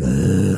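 low living-dead groan; zombie groan. Retold or imitated. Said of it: "they're just like".

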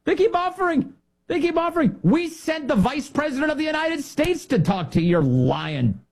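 very high-pitched indignation; worked-up, incredulous outburst; exasperated rant. Retold or imitated. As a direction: protest in disbelief, pitch jumping way up. Exasperated rant at Iran's leaders.

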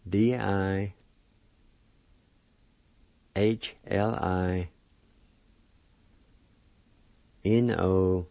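letters named one at a time with pauses; spelled out letter by letter. Shown as a demonstration. Teacher spelling the word for students, letters one by one. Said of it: "First part is spelled like this".

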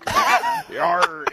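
a hammy pirate "yarr". Acted. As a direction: growl it like an old sea dog. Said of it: "in a pirate voice".

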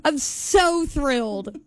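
slow, drawn-out fake thrill; sarcastic. Real, not acted. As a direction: stretch it out with heavy sarcasm. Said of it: "I'm being so sarcastic".

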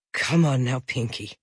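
low, soft, fading plea; crying softly. A narrator's reading. Father pleading with his unconscious daughter, soft tearful voice. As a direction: plead gently through tears. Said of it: "He was crying".

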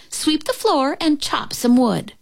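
put-on high-pitched order from an older sister; mild yell. A narrator's reading. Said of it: "yelled her older sister".

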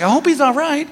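a hint of a worried wife. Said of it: "My wife's like".

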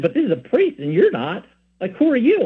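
mildly affronted, rising high at the end; indignant wonderment. Retold or imitated. Friend defends the priest to the speaker, indignant wonderment. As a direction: imitate a challenge, not quite angry. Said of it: "he wasn't that angry at me, but".

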